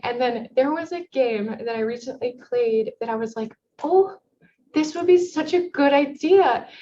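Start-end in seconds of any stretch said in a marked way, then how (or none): 3.8-6.7 higher, louder dawning "Oh"; excited retold realisation. Developer recalling a game she played, suddenly excited. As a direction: relive the spark of a great idea. Retelling moment of inspiration.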